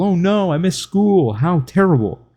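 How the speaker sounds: high, hammed-up fake panic; sarcastic high oh no; sarcastic mock alarm. Sarcastic fake alarm about school.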